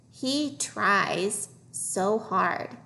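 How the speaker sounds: very slow, stretched words; slow reading model. Shown as a demonstration. Teacher modelling a phrase for young readers, slowly. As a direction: draw each word out for learners.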